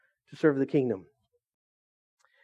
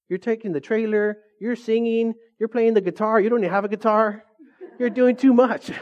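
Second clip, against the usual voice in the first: mock big-brother scolding; brother's teasing; 6 semitones higher than usual. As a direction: tease like a brother calling you out. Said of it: "He's like".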